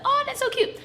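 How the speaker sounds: high delighted coo; cooing cute. Retold or imitated. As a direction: gush over something adorable. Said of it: "for me, it's like".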